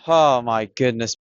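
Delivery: ordinary talk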